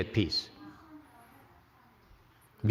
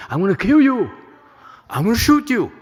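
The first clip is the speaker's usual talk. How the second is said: raised, loud acted threat; mock threat; 10 semitones higher, 6 dB louder than usual. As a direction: threaten them loudly, high-pitched, as a demonstration. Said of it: "they want to threaten you".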